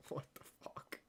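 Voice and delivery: breathy laughing whisper of disbelief; hushed amused gasp at the punchline. Real, not acted. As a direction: laugh it out under your breath. Laughing disbelief at own joke.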